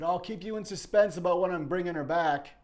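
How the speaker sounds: high, loud, put-on sneering voice of the abductor; mocking taunt. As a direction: taunt them as you speed away. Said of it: "laughing at us as they sped off".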